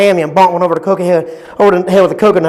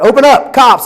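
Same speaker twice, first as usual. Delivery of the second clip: louder, higher cop bark at the door; police shout; 5 semitones higher, 5 dB louder than usual. Acted. Cops at the door demanding entry, loud and high. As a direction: bang out the order like a cop.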